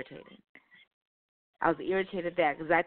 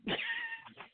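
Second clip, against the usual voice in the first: a high, squeaky burst of laughter; high squeal laugh; 7 dB quieter than usual. Real, not acted. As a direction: let out a high shriek of a laugh. Laugh at own story.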